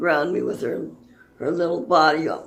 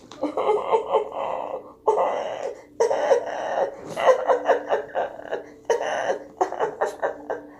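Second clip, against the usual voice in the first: hammed-up mock sobbing, a touch high; fake crying. Owner fakes crying to show her service dog responding, mock sobbing. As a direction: pretend to sob so the dog comes to comfort. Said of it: "So I'm gonna fake cry".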